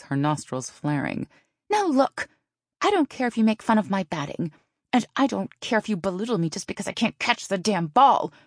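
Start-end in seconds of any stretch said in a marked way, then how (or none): 1.7-8.3 high-pitched, indignant telling-off; angry confrontation. A narrator's reading. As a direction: confront her, pitch raised in anger. Taryn angrily confronts Dale face to face.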